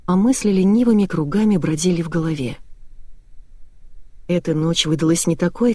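ordinary delivery

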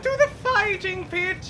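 arena announcer. Acted. Grand welcome to the arena.

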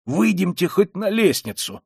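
high, slow insistence; agitated urging. A narrator's reading. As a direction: coax them out urgently. Beside himself, urging them out. (взволнованно, высоко)

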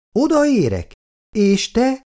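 high, puzzled double question; surprised. A narrator's reading. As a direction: Icu caught off guard, asking in surprise. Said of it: "csodálkozott Icu".

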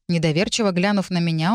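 plain reading voice

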